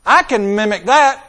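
loud, high eager exclamation; like a kid who just got an idea; Jesus, bright and eager; Jesus, delighted. Retold or imitated. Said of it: "And Jesus says".

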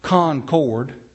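Concord said slowly as CON-CORD; two full slow syllables in Concord, CON-CORD; wrong pronunciation, spelled-out. Shown as a demonstration. Mocking how foreigners say it, Concord as CON-CORD. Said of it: "It's not Concord".

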